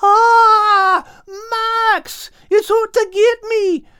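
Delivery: high, loud panicked cry; scared cry. Acted. Al cries to Max, panicked and loud. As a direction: shriek in terror at the dolphin. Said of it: "cried Al".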